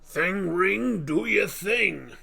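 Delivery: lightly hammed rocky hero; The Thing impression. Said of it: "The Thing".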